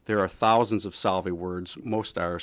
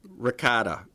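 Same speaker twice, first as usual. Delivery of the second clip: ordinary talk, nothing marked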